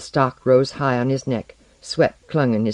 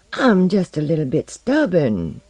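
higher, lilting Southern belle; 4 semitones higher than usual. A narrator's reading. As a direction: coyly lilt it with a Southern accent. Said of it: "lilting southern voice".

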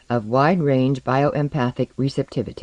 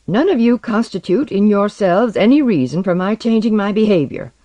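raised, louder, irritated voice; angry rejection; 6 semitones higher, 5 dB louder than usual. A narrator's reading. Man rebuffing his teammates, angry. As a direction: push back forcefully at the group.

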